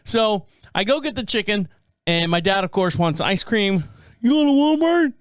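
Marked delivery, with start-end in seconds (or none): 4.2-5.1 higher, louder calling-out voice of dad; imitating his dad. Dad calling to his son going out, mock eager. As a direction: call out like a dad wanting something.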